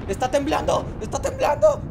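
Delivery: high, loud alarm; panicked shouting. Pretend. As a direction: raise the alarm in comic panic. Panics at shaking. (gritos agudos de pánico)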